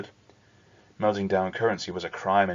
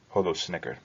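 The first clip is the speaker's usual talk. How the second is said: usual speaking voice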